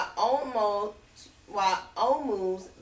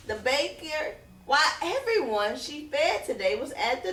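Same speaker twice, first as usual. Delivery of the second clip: building excitement; 6 semitones higher, 3 dB louder than usual. A narrator's reading. Excited list of visitors.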